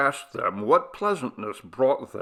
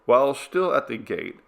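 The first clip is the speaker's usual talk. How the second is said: ordinary delivery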